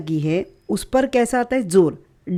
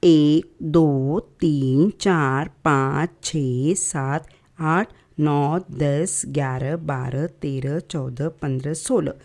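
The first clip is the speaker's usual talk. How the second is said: counting the vowels aloud, slow and lower, drawn out; 5 semitones lower, 4 dB quieter than usual. Shown as a demonstration. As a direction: count them off slowly like ticking a list. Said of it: "स्वर कितने हो गए हमारे?".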